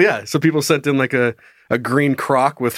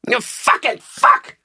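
slow, high squawked swearing; mock parrot screaming, strained and drawn out; 7 semitones higher, much slower than usual. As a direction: screech it like a parrot. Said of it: "he's just screaming".

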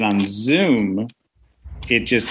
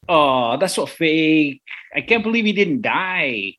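put-on kid voice; 5 semitones higher, slower than usual. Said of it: "a kid behind me who goes".

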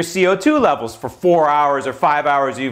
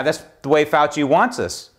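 ordinary talk

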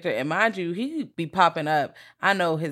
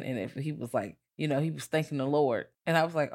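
ordinary talk

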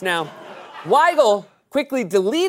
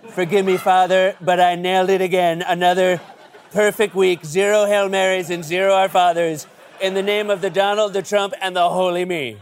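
loud, boastful put-on Trump voice; Trump in confession; 2 semitones higher, 6 dB louder than usual. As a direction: brag to the priest like Trump. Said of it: "I would love to see Trump in a confessional".

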